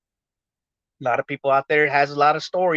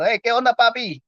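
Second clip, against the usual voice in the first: high, loud mock Puerto Rican greeting; greeting Papi; 9 semitones higher, 4 dB louder than usual. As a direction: call out Papi like a New York friend.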